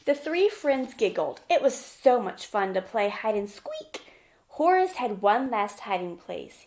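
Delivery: put-on bright, lively read-aloud for little kids; gentle children's story narration; storybook reading. A narrator's reading. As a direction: read it like a picture book at story time. Reading picture book to children.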